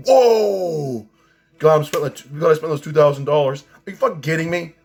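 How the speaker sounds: high, loud awestruck whoa, then gushing; hammed-up thrill at expensive gear; mock amazed audiophile. Audiophile marveling at his two thousand dollar headphones, mock amazed.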